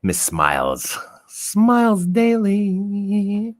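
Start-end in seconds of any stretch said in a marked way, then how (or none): 1.3-3.5 high stretched singsong name; high drawn-out call. Pretend.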